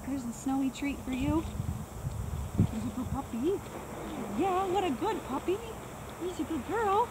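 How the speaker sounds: high, soft sing-song praise; dog praise voice. Real, not acted. Trainer praising her dog Lucy, high soft sing-song.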